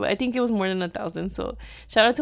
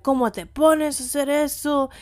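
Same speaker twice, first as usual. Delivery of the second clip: imitating an angry father's scolding, higher pitched; retold outraged telling-off, pitched up; pissed-off dad; 5 semitones higher than usual. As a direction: scold like an indignant dad in Spanish. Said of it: "my dad was so pissed off at me".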